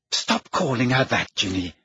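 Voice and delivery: ordinary delivery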